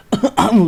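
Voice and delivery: loud throaty cough; a sharp hacking cough; real cough. Real, not acted. The narrator breaks off coughing loudly.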